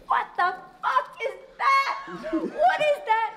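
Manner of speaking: high, drawn-out cry of disbelief; shocked outburst. Retold or imitated. Director voices a character seeing the monster, shocked outburst. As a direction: act out appalled shock, laughing through it. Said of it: "and he's like".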